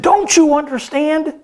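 like a man at the end of his rope; angry businessman. A narrator's reading. Businessman angry at the fisherman, exasperated high voice. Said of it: "the businessman was getting angry".

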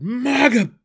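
high, loud call; loud cry. Acted. Gnome cries out.

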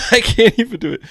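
laughing at herself. Real, not acted. Laughing at failed impression.

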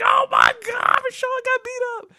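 very high hooting onlookers; mocking crowd. Everybody laughs at him after the fight, high mocking shriek. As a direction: shriek like kids enjoying someone's beating. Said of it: "Everybody's laughing. They're like".

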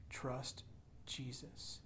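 soft, breathy plea to trust; almost whispered appeal; quiet whispered plea; hushed urging. Real, not acted. Pastor urging softly.

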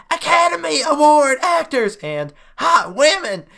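very high, loud yelled reading; shouted, high-pitched review read; all-caps yell. Shown as a demonstration. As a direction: holler it like capital letters. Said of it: "yell when we do it".

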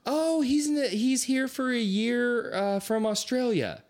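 proud parent answering small talk; chirpy parent. Retold or imitated.

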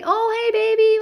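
put-on sing-song baby greeting. Mom greeting toddler in high sing-song baby talk. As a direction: coo hello to a toddler. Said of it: "and I say".

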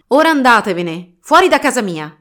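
loud, sharp order to leave; loud dismissal. Acted. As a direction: clear them out of the house. Master drives women out.

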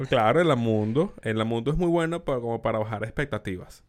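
ordinary talk

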